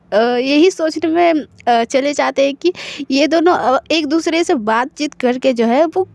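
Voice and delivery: ordinary delivery, nothing marked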